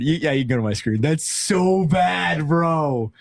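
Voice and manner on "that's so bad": "so" drawn out high and slow; stress on so. Real, not acted. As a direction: stretch "so" in "That's so bad". Reaction again.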